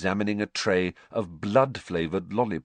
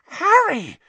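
sudden high, loud squeal of surprise; 10 dB louder than usual. A narrator's reading. Hermione spotting Harry, squealing loudly. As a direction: shriek his name in delight. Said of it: "squealed Hermione".

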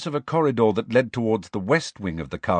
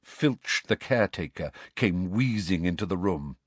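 plain reading voice

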